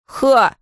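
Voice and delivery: loud mocking "ha"; scornful snort. A narrator's reading. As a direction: snort with contempt. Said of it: "фыркнул другой".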